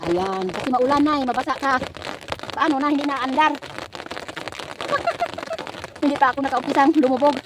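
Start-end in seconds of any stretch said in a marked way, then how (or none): 3.6-6.0 cackling laugh. Real, not acted. The phone stopped working.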